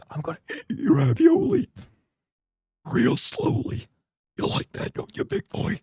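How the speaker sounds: high, wheezy put-on whisper; mock sultry ASMR. Streamer parodying racy ASMR for chat, creepy breathy tease. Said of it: "A S M R porn going hard".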